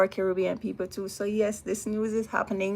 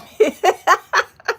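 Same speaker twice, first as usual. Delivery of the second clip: high burst of laughter; 12 semitones higher, 10 dB louder than usual. Real, not acted. Laughing at her own joke.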